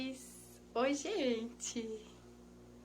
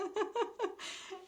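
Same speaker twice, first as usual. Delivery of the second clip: delighted laugh; 8 semitones higher, 4 dB quieter than usual. Real, not acted. Delighted by classmate in chat.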